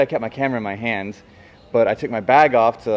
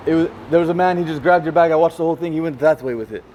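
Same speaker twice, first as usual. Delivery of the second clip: put-on light Romanian-accented man, a bit higher; 5 semitones higher than usual. Retelling the man who saw the theft, in a light Romanian accent. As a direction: mimic the Romanian bystander's accent. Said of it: "That was a great accent".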